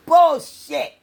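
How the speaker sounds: bullshit spat out high and loud. Real, not acted. Repeated louder and higher.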